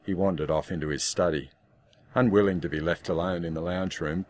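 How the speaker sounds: plain reading voice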